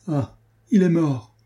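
high, slow cry of despair; anguished cry. A narrator's reading. Adrienne fearing her fiancé dead, anguished high cry. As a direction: she feels the ring and cries out. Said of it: "s'écria-t-elle".

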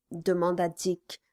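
plain reading voice

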